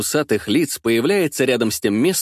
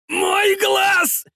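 a pained shriek, far higher; howling in pain. Acted. Stabbed thug howling about his eye, pained shriek. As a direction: scream as the beak hits the eye. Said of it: "орёт он, точно от боли".

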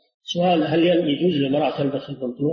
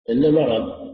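ordinary delivery

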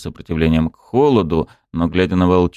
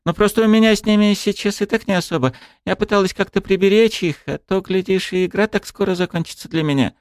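put-on young woman voice. A narrator's reading. Wolf girl voiced throughout.

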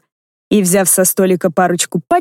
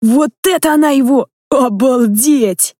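put-on excited child; 6 semitones higher than usual. Child amazed by the video.

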